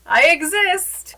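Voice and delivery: high, loud, slow timid hello; awkward high greeting. Retold or imitated. Entering a kitchen where everyone stares, awkward high greeting. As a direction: squeak it out to a staring room. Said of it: "And I'm just there like".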